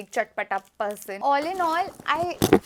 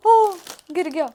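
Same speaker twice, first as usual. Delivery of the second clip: surprised; 6 semitones higher, 8 dB louder than usual. Real, not acted. Candy dropped.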